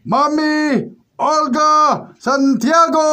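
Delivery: drawn-out slow winner reveal; grand announcement. Acted. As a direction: stretch the name out like a showman. (mabagal at engrandeng pagpapahayag)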